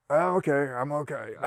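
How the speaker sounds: soft, strained, worn-out answer; weak post-surgery reply. Retold or imitated. Patient after an operation answering across the ward, soft and strained. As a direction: sound hurt but brave.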